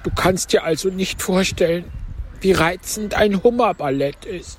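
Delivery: high, plaintive turtle voice; Mock Turtle. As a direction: pitch it up for the tearful turtle. (hohe Stimme der falschen Schildkröte)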